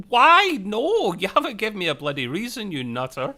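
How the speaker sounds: high, loud, outraged squawk on the first why, then settling; hammed-up partner losing it; a mock frantic rant that starts high and loud and cools down; exasperated Stamets. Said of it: "Stamets is just like".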